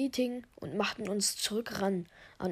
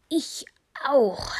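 short, acted call, getting louder; called-out agreement; 5 semitones higher, 6 dB louder than usual. Doctor seconds the vote, calling out loudly. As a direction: call it out, punching the second word. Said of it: "rief Jennifer".

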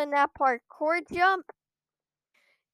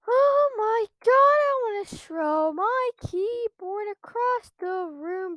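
much higher, strained outburst, slow; raging frustration; 8 semitones higher, slower than usual. Real, not acted. Gamer raging at failed jump, high strained outburst. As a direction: let the fury spill out. Rage after failing again.

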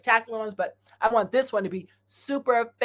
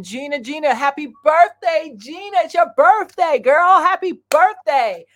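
excited birthday shout-out; 7 semitones higher, 8 dB louder, slower than usual. Real, not acted.

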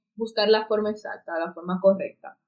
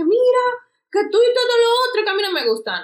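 grito agudo y fuerte, peleando; 13 semitones higher, 4 dB louder than usual. Shown as a demonstration. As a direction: reclama a gritos, con rabia fingida. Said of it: "vayas como que a pelear". (gritando como para pelear, más agudo y fuerte)

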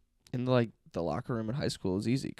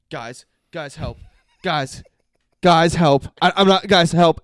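rising, louder fake panic cry for help; mock panicked plea; 6 semitones higher, 16 dB louder than usual. Retelling frat brother pleading with the guys, hammed-up panic.

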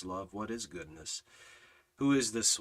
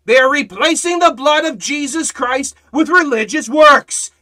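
full-force preaching; 12 semitones higher, 17 dB louder than usual. Acted. Preacher proclaiming Jesus's words to the congregation, booming. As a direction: thunder each I am from the pulpit. Preacher rebuking works-based salvationists.

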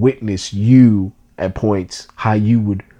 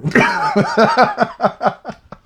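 a high, breathy burst of laughter. Real, not acted. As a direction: laugh at yourself, high and free. Laughing at his own size.

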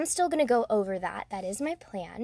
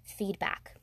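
ordinary delivery

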